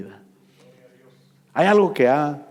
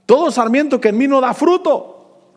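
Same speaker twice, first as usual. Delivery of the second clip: loud, high proclaiming preacher; preaching at full force; 12 semitones higher, 6 dB louder than usual. Acted. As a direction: ring out the verse from the pulpit. (predicación a todo pulmón)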